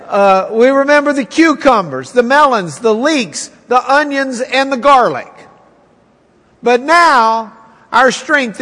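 poor pitiful us, wallow in it; moaning Israelites. Acted. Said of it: "they start moaning".

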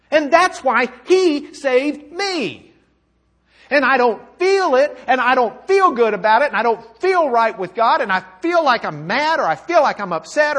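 fervent, insistent testimony in the believer's voice; impassioned confession. Acted. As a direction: pile up each admission with mounting force.

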